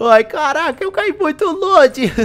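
high, thrilled voice; excited. Real, not acted. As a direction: gush about the long jump. Thrilled after the long jump.

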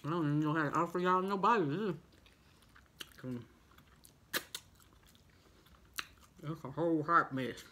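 talking between bites of food; savoring food while speaking; mouth full, chewing. Real, not acted. Seen 0: Bit coney at -4; mouth chewing, fingers licked while speaking.

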